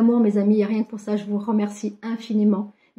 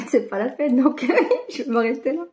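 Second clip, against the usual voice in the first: laughing it off; 3 semitones higher than usual. Real, not acted. Joking about her English accent.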